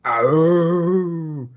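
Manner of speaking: loud mock wolf howl; werewolf howl. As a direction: let out a playful howl. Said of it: "that was a giant-sized werewolf howl".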